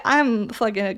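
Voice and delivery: usual speaking voice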